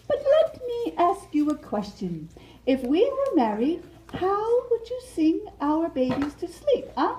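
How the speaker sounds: high, giggling, flattered butterfly; giggly Mariposa. Acted. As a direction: laugh shyly and flirt with the suitor. Mariposa voice, held role.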